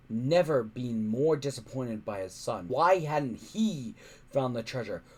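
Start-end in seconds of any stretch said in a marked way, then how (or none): none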